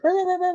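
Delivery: very high-pitched rapid babble; babbled mock rant. Driver mimicking his own outburst, high sputtered rant. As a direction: sputter nonsense syllables way up high.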